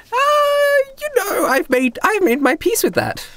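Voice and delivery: put-on very high, girlish voice; cheerful girl agent. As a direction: shrug off a threat in a high girl's pitch. Girl agent shrugs off threat.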